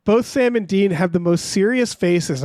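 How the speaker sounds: normal talking voice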